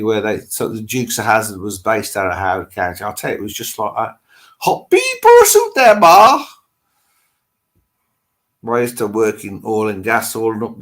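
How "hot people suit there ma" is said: much higher, louder hammed-up Texan holler; mock Texan yell. Telling viewers about Texas, a hammed-up Texan holler. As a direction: holler it like a Hazzard County good ol' boy. Evoking Dukes of Hazzard Texas.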